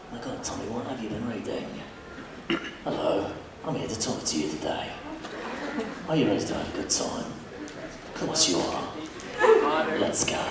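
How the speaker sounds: very low, soft, gruff British accent. Shown as a demonstration. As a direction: drop down into a quiet British growl. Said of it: "they want something more gruff".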